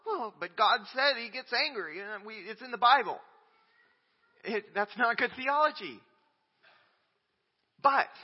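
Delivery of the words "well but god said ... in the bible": higher, whiny objector voice; lightly voiced skeptic; mock objector. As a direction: play the doubter's protest. Mimicking an objector.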